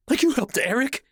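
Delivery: high, biting retort; snapped. Acted. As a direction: snap it back bitterly. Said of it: "she snapped".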